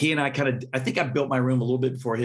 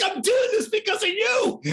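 much higher, half-crying disbelief; shocked high shout. Retold or imitated. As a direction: cry it out in shock. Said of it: "I'm like".

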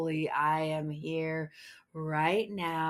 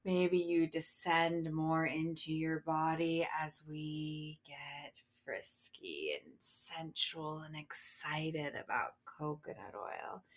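ordinary talk, nothing marked

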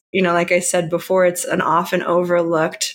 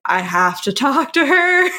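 eager retold thought; 7 semitones higher than usual. Retells her eager thought, laughing.

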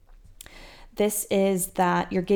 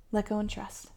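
"Let go and trust" repeated softer, almost to herself; softer echo; 9 dB quieter than usual. Shown as a demonstration. The coach repeats the mantra for her listeners, softer echo. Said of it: "here's my sign that I just need to let go and trust".

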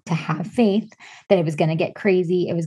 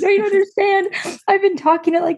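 high-pitched mock-distraught pleading; imitated tearful plea; 13 semitones higher, 3 dB louder than usual. Patient retells pleading with a new doctor, high and overwrought. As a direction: replay your own emotional outburst, voice way up high. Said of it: "I was crying in his office".

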